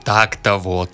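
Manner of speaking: ordinary delivery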